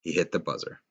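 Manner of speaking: ordinary delivery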